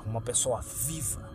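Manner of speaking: plain reading voice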